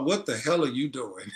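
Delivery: ordinary talk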